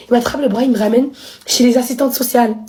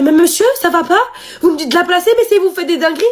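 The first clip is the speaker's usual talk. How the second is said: mock scolding worker; 6 semitones higher than usual. Social worker scolding father.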